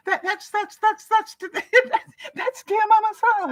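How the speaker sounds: high, stammering fan outburst through laughter; starstruck mock scream. Viewer telling the whole spa who just walked in, starstruck mock scream. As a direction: stutter it out high, imitating an overwhelmed fan. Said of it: "and she screamed".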